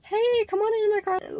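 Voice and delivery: high-pitched mock invitation; acted chummy high voice; friendly Tesla owner. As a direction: call it out high, like an over-friendly stranger. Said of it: "this Tesla owner that just walks up to me and is like".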